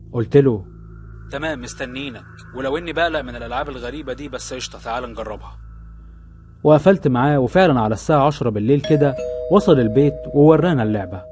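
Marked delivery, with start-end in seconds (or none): 1.3-5.6 quiet, hesitant reply, quickening; hushed suspense. Acted. Narrator's phone reply quoted. (رد خافت متردد)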